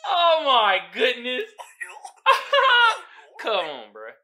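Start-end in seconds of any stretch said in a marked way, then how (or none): 0.1-1.5 slow, high laughing exclamation. Real, not acted. Laughing at meme. 1.5-3.3 loud high-pitched laughter. Real, not acted. Laughing at meme.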